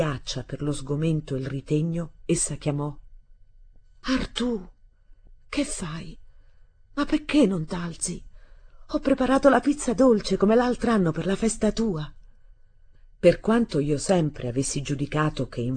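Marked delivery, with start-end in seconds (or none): 4.0-12.1 higher, hesitant call; uncertain voice. A narrator's reading. Stepmother calling a boy through the door, uncertain voice. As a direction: call out timidly, half held back by dismay. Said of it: "con una voce malcerta".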